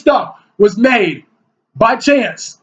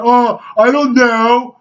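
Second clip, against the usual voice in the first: mocking shrug; much slower than usual. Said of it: "He's like".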